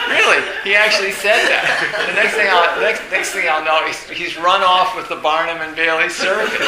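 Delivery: laughing loudly through the retold quote; can barely get the words out for laughing. Real, not acted. Teacher retelling a colleague's jibe, laughing hard.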